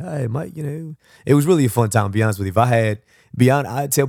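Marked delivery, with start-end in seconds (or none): 0.0-0.6 brief, higher and softer mock Aussie greeting; Australian accent. Joking about a trip to Australia, mock Australian accent. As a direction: toss in a light Australian accent. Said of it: "I went to Australia".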